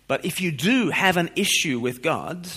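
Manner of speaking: ordinary delivery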